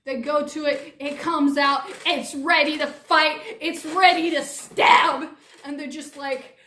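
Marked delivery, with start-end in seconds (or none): none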